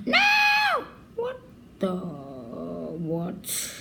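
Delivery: shocked cry; shocked shout. Real, not acted. Sudden shock at game.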